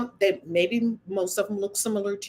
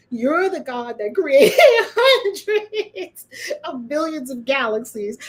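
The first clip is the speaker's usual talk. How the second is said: slow, laughing through the words; incredulous giggling; laughing disbelief; 4 semitones higher, 4 dB louder, slower than usual. Real, not acted. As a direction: savor the absurdity while laughing. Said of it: "my funny bone is on tonight".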